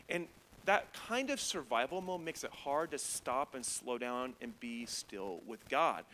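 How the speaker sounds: ordinary talk, nothing marked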